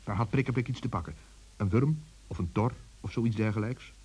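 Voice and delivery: ordinary delivery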